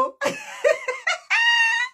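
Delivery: real shrieking laugh. Real, not acted. Cracking up at the fight.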